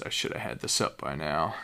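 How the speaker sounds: ordinary talk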